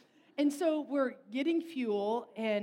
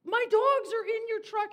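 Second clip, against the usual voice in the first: high, loud, overjoyed cry; the owner spotting his dogs in the truck, crying out in joy; ecstatic owner; 12 semitones higher, 8 dB louder than usual. Retold or imitated. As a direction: shout it like you just found your lost dogs. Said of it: "he's like".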